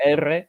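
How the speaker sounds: R said as Spanish "erre", trilled; name the letter "R" in Spanish with a rolled trill. Shown as a demonstration. Learner showing which sound to aim for, R as a trilled Spanish "erre". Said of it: "You wanna say the R".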